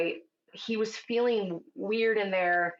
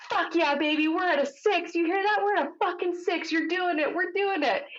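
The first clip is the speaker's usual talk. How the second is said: jazzed-up husband; 11 semitones higher than usual. Retold or imitated. Husband cheering his wife on from the corner, excited yelling. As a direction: imitate the husband whooping it up. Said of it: "He's so jazzed up in the corner".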